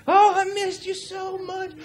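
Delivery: put-on very high-pitched little-girl gush; imitated gushing daughters. Imitating his girls greeting dad, high gushing. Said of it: "the girls, they'll come running up".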